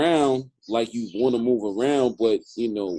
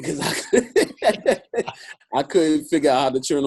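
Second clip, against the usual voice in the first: laughing; 5 semitones higher, 4 dB louder than usual. Real, not acted. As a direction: laugh through the confession.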